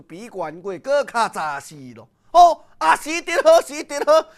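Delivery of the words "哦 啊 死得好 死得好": high, loud gloating cry; loud delight that the old enemy is dead; gleeful shout. Acted. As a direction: crow with triumph over the news. Character gloats over enemy's death.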